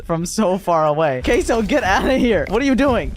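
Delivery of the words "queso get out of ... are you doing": high-pitched scolding across a distance; exasperated calling out. Real, not acted. Park owner orders Queso out, high and exasperated.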